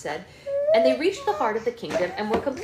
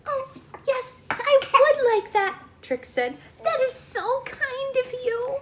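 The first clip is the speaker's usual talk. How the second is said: high fairy voice; slower than usual. Acted. Fairy Trix answers gratefully.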